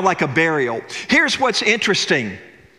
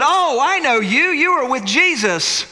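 put-on accusing servant girl; 9 semitones higher than usual.

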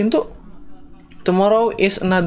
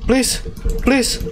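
scared pleading; slower than usual. Real, not acted. Begging Freddy not to attack.

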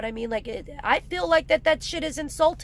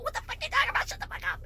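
high squeaky scoff; 13 semitones higher, 6 dB quieter, much faster than usual. Real, not acted. Mocking exasperation.

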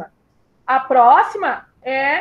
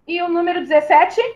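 ordinary talk

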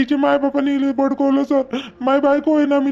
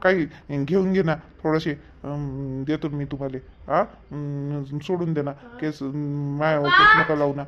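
much lower, much softer wheedling; whispered bribe offer; 9 semitones lower, 7 dB quieter than usual. Acted. Husband quietly offers the cop money to settle, hushed. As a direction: murmur the bribe under your breath.

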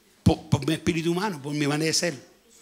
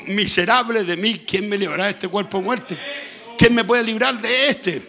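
loud, raised preaching cry; impassioned lament; 3 semitones higher, 4 dB louder than usual. Acted. Preacher quoting scripture about his own misery, loud lament.

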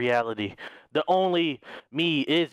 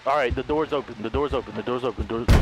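ordinary delivery

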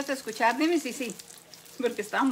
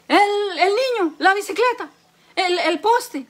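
loud, higher-pitched mock alarm; excited caller, louder and higher; calling out lottery cards; 6 semitones higher, 7 dB louder, slower than usual. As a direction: shout out each thing as if it were a card. Wife calls out lottery cards.

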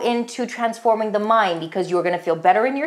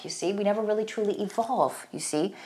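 soft aside; 8 dB quieter than usual. Real, not acted.

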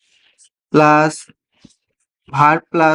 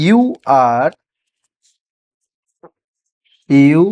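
very slow dictation; 3 semitones lower, much slower than usual. Shown as a demonstration. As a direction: stretch you are watching the video slowly for the class. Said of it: "তাহলে কি বলবো?".

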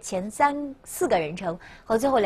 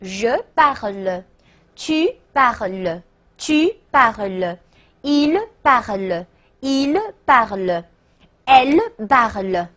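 slow French, pronoun and verb separated; slow read-along model; slower than usual. Shown as a demonstration. Teacher leading students through a conjugation, slow read-along model. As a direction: draw out each word for repetition. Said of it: "跟我读啊".

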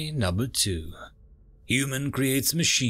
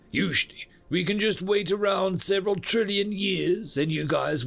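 lightly voiced universe, higher than narration; earnest universe; 6 semitones higher than usual. Universe explaining life's purpose to humans, mildly insistent. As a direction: explain it in a raised character voice.

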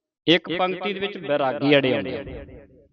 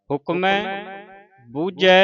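ਹੁਕਮੈ ਬੂਝੈ raised higher, each word set apart; pause after bujhai; 5 semitones higher than usual. Shown as a demonstration. Teacher marking the pause after ਬੂਝੈ, higher. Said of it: "ਇੱਥੇ ਬੂਝੈ ਤੇ ਵਿਸਰਾਮ".

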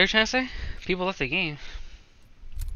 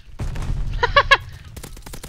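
short high-pitched cackle; real high giggle; gleeful laugh. Real, not acted. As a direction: laugh in triumph over a kill. Laughs after a kill.

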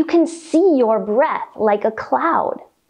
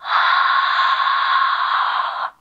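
long, open-mouthed breath out; foggy exhale. Shown as a demonstration. Yoga teacher showing kids winter breath, a long foggy exhale. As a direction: breathe out like fogging a window. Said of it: "let it all out through our mouth like we're fogging up a window".

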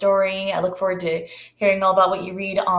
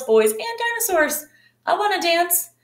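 eager dinosaur; 11 semitones higher than usual. Acted. Voicing the little dinosaur.

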